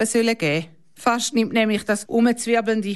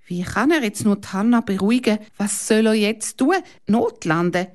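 usual speaking voice

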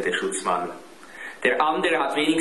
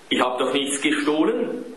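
usual speaking voice

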